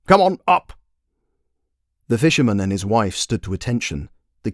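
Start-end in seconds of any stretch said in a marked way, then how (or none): 0.1-0.7 louder, higher royal command. Acted. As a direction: order them to their feet. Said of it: "commanded the king".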